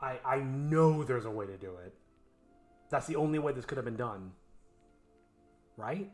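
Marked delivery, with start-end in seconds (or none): none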